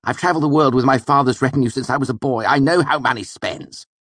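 fast, high, loud bragging; indignant boast. Acted. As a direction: rush the boast out haughtily.

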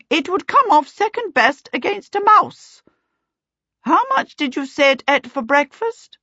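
high, emphatic aunt voice; opining aunt. A narrator's reading. Aunt Sempronia judging tiny Waif, opining. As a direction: scoff as a lofty aunt. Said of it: "Aunt Sempronia opined".

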